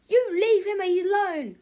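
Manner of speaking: put-on high, loud kid's yell. A narrator's reading. George yells at Big Tippy to leave him alone. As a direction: shout at the villain to back off. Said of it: "yelled George".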